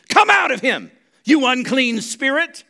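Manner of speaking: loud, high exorcism command; booming order with drawn-out final words; commanding rebuke. Acted. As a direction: cast the spirit out forcefully. Acting out Jesus casting out spirit.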